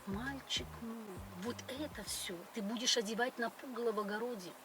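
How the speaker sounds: ordinary talk, nothing marked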